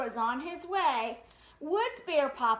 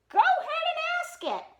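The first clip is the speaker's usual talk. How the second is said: impatient; 12 semitones higher, 5 dB louder, faster than usual. Acted. Papa impatient.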